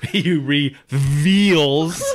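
"reveals" drawn out slowly in a Russian accent; Chekhov accent. Shown as a demonstration. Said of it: "my Chekhov impersonation from Star Trek".

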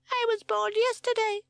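very high, loud squeaky voice; proud chick. A narrator's reading. Bundle of fluff answering Sid, proud squeak. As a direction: pipe it up proudly. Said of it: "puffed itself up proudly".

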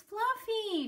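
put-on girl searching for her guinea pig, a loud high call. A narrator's reading. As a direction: call for the lost pet. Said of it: "she called".

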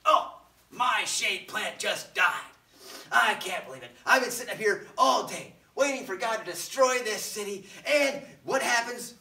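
loud, whiny mock outrage; angry Jonah. Jonah complaining that his shade plant died, loud indignant whine. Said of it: "Then Jonah gets angry".

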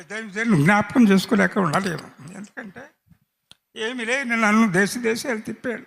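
fighting back tears remembering his mentor; choked up. Real, not acted.

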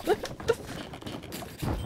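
soft unvoiced laugh; breathy laugh. Real, not acted. Laughs after dead Tamagotchi joke.

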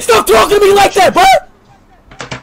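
high, loud, cracking cry-shout; anguished yell. Real, not acted. Streamer yells back at the insulting opponent, cracking shout. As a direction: scream it, near tears.

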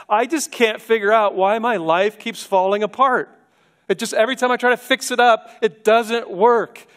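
high, exasperated complaint, quick then dragged; frustrated sinner. Pretend. Preacher voicing a frustrated person, high and exasperated. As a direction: whine like someone whose life keeps breaking.

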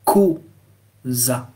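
slow "cosa", split as ko, za; Italian pronunciation. Shown as a demonstration. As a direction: model "cosa" syllable by syllable with a hard k. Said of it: "on lira".

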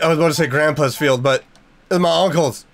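ordinary talk

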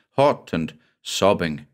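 plain reading voice

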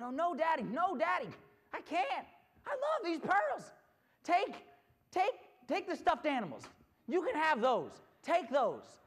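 high, loud acted begging; pleading little girl. Girl bargaining with daddy to keep pearls, high-pitched pleading. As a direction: plead urgently like a child bargaining.

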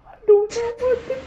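high, fast panicked squeal. Real, not acted. Panicked game moment, laughing.

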